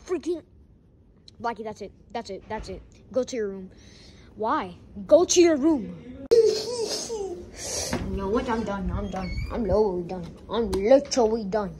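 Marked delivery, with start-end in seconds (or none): none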